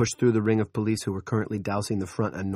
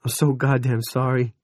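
near wail; 4 semitones higher, 6 dB louder than usual. Acted. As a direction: voice loud and cracking with grief. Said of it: "He was nearly wailing".

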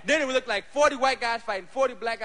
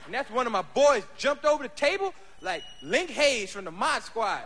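ordinary talk, nothing marked

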